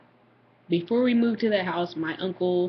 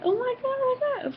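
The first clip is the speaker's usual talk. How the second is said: very high squeal of alarm; hammed-up high-pitched alarm; mock panic. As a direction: imitate a freaked-out sister, way up high. Sister reacts to floating phone.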